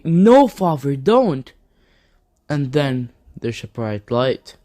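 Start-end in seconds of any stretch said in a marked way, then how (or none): 0.1-1.5 mock scream, louder and higher; Gohan. Son begging his father not to kill, pleading scream. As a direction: plead loudly, a half-scream to stop him. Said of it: "Gohan would scream and say".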